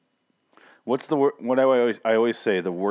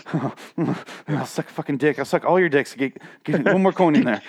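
higher, frantic mock junkie voice; junkie fiend; 5 semitones higher than usual. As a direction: beg like a desperate gambling addict. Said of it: "becomes, like, a fucking junkie fiend".

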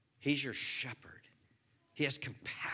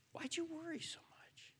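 gentle God voice; 9 dB quieter than usual. Pretend. God speaks to Todd.

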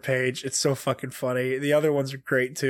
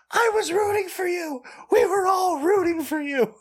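very high, mock-hysterical cry; hammed-up outrage. Fan feels let down by a character, hammed-up outrage. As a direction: wail it far up high in mock betrayal. Acted outburst within fan reactions.

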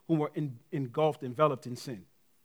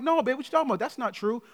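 higher-pitched, mock-incredulous dad; protesting dad; 8 semitones higher than usual. Dad tells daughter that's not true, mildly protesting. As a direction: lightly act out a father's quick denial.

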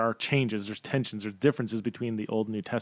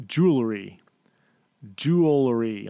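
saying it really slowly; 4 dB louder than usual. Shown as a demonstration. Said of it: "I have to say it really slowly".

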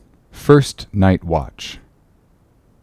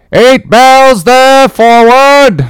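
very high, loud slow call; distant shouted order; 7 dB louder, much slower than usual. Acted. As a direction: bellow it from the quarterdeck. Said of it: "Mate's voice from the quarterdeck".

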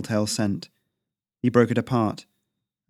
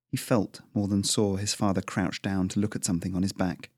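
usual speaking voice

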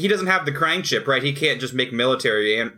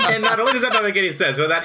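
laughing; 8 semitones higher, 3 dB louder, much faster than usual. Real, not acted. Laughing at a crude joke.

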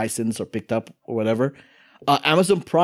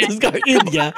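Chhattisgarh, India in a put-on Indian accent; 7 semitones higher, 3 dB louder than usual. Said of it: "don't do the accent".

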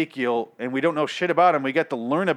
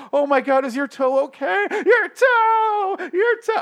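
very high falsetto, louder; hammed-up squealing concern, stretched out at a much higher pitch; mock alarm; 6 dB louder, slower than usual. Reviewer parodying characters fussing over a toe, shrill mock alarm. Absurd acted reaction to stubbed toe.

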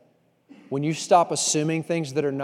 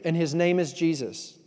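usual speaking voice

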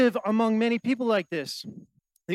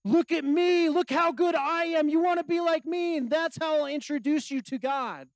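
high-pitched, showy self-promotion; boastful religious achiever; 8 semitones higher than usual. Acted. Religious achiever showing off to would-be followers, boastful. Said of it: "religious achiever loves to be depended on".

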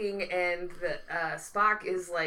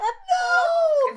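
high, loud drawn-out no; hammed-up wail of protest; mock anguish; 9 dB louder than usual. Co-host protesting the pretzel vendor's name, wailing mock horror.

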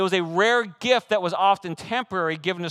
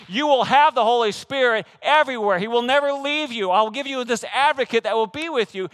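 excited; 6 semitones higher, 5 dB louder than usual. Real, not acted. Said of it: "Can you tell I'm getting a little excited?".

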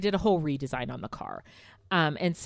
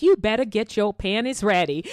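higher, louder playful sass; silly sassy dictation; 5 semitones higher, 5 dB louder than usual. Retold or imitated. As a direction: boss him around as a joke. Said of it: "I like saying all this weird, fucked up".

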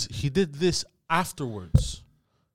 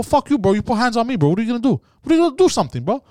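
higher, louder, escalating dare; retold rage; 8 semitones higher, 4 dB louder, faster than usual. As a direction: taunt him to make a move. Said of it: "I go".

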